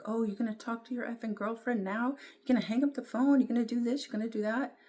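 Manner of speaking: lightly voiced jealous rant; wife cussing out her husband, mock angry; imitating an angry wife. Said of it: "cussing him out".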